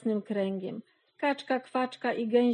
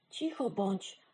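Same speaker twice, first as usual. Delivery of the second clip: hushed scolding; 7 dB quieter than usual. A narrator's reading. Hens telling the duck to be quiet, hushed scolding. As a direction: shush them sternly under your voice. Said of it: "zgromiły ją kury".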